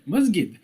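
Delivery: 'masjid' with a hard g, mas-gid; Egyptian hard g. Retold or imitated. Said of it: "pronounce the letter Jim as Gim".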